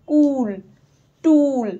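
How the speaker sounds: teacher modelling the long oo; long oo demo. Shown as a demonstration. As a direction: stretch the oo in কুল and টুল. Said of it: "Harsh উটা অনেকক্ষণ ধরে বলা হচ্ছে".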